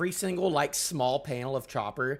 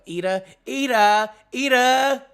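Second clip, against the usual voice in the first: loud, high complaining wail; mock whine; 8 semitones higher, 10 dB louder than usual. Character wailing that Ida blocks their bromance, loud mock whine. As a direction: whine her name over and over.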